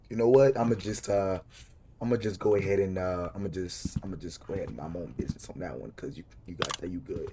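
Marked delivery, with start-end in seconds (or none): 3.0-7.3 sheepish retreat. Pretend. Backing down from game character.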